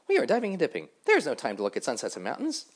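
loud, high, boastful dragonflies; brash dragonfly voice. Acted. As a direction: call out high and loud, showing off.

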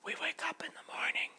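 hushed, breathy little butterfly voice; whispering butterfly. Acted.

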